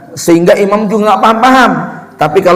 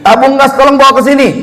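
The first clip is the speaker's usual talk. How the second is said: high, hurried call across the house; imitating a wife calling out from afar; mock yelling wife; 9 semitones higher, faster than usual. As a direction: holler for help from the stove, pitched up. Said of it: "teriak-teriak".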